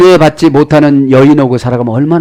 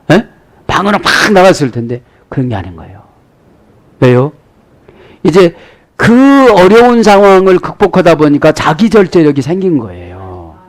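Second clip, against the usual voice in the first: ordinary talk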